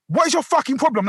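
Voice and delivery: retold irate question; acted angry challenge. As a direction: re-enact squaring up in a row. Retold confrontation with the women.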